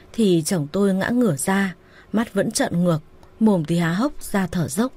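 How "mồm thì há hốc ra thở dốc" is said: ordinary delivery, nothing marked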